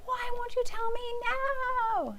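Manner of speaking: put-on high, loud pleading kid voice; a child nagging mom over the ball, high and insistent; whiny child.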